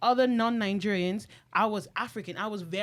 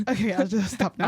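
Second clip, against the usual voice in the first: laughing; 4 dB louder than usual. Real, not acted. Laughing at her own tangent.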